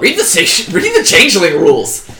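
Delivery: loud, high-pitched outburst; exasperated shout. Real, not acted. GM orders player to check rules.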